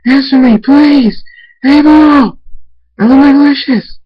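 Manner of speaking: full-voiced, high, anguished shouting; frantic yell. A narrator's reading. Father in backyard calling missing Abel, frantic yell. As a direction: scream the name across a yard, pleading. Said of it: "go outside to the backyard and yell".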